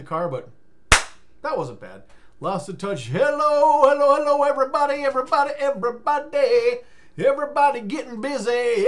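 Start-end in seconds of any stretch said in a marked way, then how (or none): 3.1-8.9 high, loud sing-song announcer intro; hype greeting. Acted. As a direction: greet the crowd like a hyped host.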